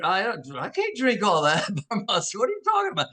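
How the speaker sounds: high-pitched protest with a laugh; amused mock disbelief; retold bafflement. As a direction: protest incredulously, voice leaping up. Retold baffled protest over champagne.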